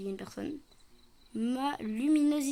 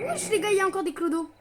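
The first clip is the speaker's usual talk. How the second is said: high, loud startled cry; startled exclamation; 8 semitones higher, 7 dB louder, faster than usual. Real, not acted. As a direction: blurt it out, caught off guard. Startled by more bums.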